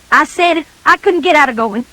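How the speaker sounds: ordinary delivery, nothing marked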